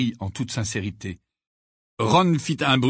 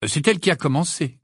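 higher-pitched, defensive protest; 6 semitones higher, 3 dB louder than usual. Acted. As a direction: shift the blame onto her. Harry defends himself. (sur la défensive)

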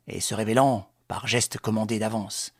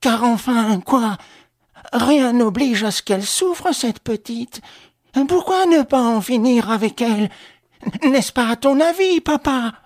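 high, loud outburst of protest; agitated, indignant protest at full voice; sudden revolt; 13 semitones higher, 8 dB louder than usual. A narrator's reading. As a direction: burst out pleading against the plan, pitched way up. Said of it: "un accès de révolte qui secoua subitement".

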